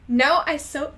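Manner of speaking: starts the line loud and high in the wrong character's voice; a slip into the other character's loud voice. Acted. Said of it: "wait, no, wrong voice".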